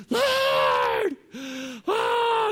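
very high shouted cry to the Lord; Loke's loud prayer. Retold or imitated. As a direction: howl it out like beating your breast. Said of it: "when he prays, he's really loud".

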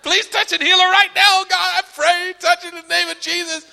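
high, urgent mock prayer; faithless showy prayer. Preacher praying over hurt woman, putting on fervour. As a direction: pray hard while doubting it works. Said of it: "this faithless prayer".

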